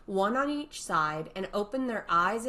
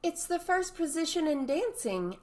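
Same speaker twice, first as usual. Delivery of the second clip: puzzled Alice; 10 semitones higher than usual. A narrator's reading.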